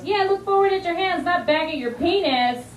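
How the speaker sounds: put-on high, cheeky little-boy voice; mocking kid. Little boy mocking the yoga teacher, high and cheeky. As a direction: parrot the teacher like a smug five-year-old. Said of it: "This little boy, Oliver, goes".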